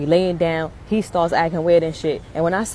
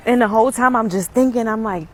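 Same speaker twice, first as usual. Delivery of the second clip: ordinary talk, nothing marked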